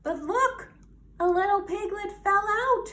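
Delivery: high and loud, quick gasp of news; excited discovery. Real, not acted. Reader showing children the falling piglet, high and excited. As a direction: point out the falling piglet, thrilled. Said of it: "reader points at piglet".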